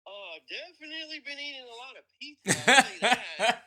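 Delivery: laughing through the words; chuckling while answering. Real, not acted. Laughing answer about pizza.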